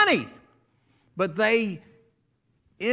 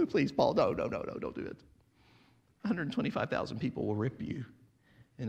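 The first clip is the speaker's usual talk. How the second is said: mock pleading; 8 semitones lower, 6 dB quieter than usual. Friends begging Paul to stop.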